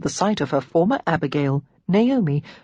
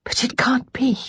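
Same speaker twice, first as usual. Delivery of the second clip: higher, disbelieving whisper; hushed, breathy disbelief; 7 semitones higher than usual. A narrator's reading. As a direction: whisper it in shock. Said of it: "she whispered".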